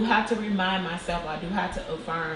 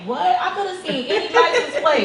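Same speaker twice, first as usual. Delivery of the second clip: amused disbelief; 10 semitones higher, 6 dB louder than usual. Retold or imitated. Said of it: "And I'm like".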